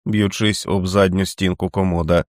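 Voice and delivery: ordinary delivery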